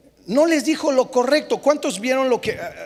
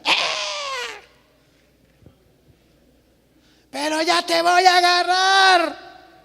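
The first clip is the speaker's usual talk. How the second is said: yelling mom; 8 semitones higher, slower than usual. Retold or imitated. Mother yelling at her child, hammed-up high threat. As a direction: yell at the kid without meaning it. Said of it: "la mamá que grita".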